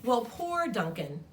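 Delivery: usual speaking voice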